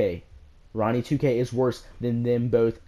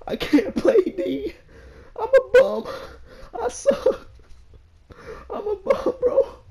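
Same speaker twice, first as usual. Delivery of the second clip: mock crying; slower than usual. Mock self-pity after bad defense.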